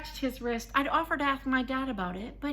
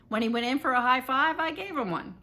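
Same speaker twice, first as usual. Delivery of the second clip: ordinary delivery, nothing marked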